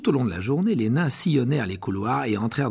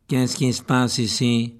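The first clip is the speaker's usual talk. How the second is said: drawling; 4 dB louder, slower than usual. Acted. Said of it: "la voix traînante de Drago Malfoy".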